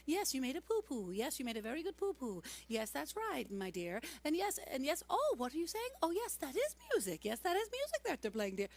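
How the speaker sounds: put-on high, sing-song baby talk to a toddler; cooing mother. Mother coos over her toddler's diaper, high sing-song baby talk. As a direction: coo it like a mum changing a diaper. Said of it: "hearing her talk to her child".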